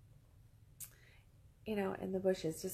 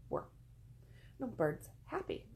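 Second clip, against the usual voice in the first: ordinary delivery, nothing marked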